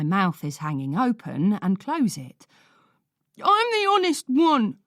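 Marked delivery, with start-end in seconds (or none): 3.4-4.8 much higher, loud crying protest; tearful shout. A narrator's reading. Boy crying that nobody believes him, tearful shout. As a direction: shout through tears. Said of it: "Lloyd shouts, tears falling".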